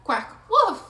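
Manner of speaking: loud, high quack then bark; duck and puppy calls. Acted. Duckling and puppy playing together, loud quack and woof. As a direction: trade animal calls.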